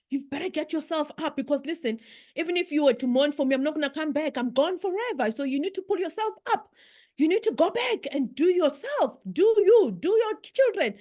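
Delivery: high, insistent retold scolding; tough-love mother. Mother telling her daughter to move on, imitated tough love. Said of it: "she gave me what we call tough love".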